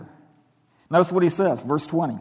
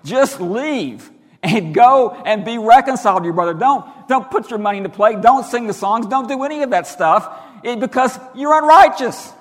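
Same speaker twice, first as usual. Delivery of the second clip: loud preaching, building higher and more forceful; emphatic; 3 semitones higher, 7 dB louder than usual. Retold or imitated. Preacher voicing Jesus telling worshippers to leave and reconcile, emphatic. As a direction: drive each command home, rising in volume. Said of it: "he's so emphatic about it".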